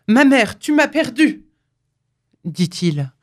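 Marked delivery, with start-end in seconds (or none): 0.1-1.4 a frightened son, louder and higher; stern but frightened. A narrator's reading. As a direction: cry it out in alarm and reproach. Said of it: "d'un air sévère, mais effrayé". (sévère mais effrayé, plus fort et plus aigu)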